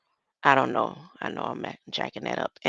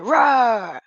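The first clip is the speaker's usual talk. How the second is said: loud playful roar, pitched up; kid's lion roar; 6 semitones higher, 9 dB louder than usual. Retold or imitated. Grandson roaring for grandma, loud playful roar. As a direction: belt a child's pretend lion roar. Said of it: "He said".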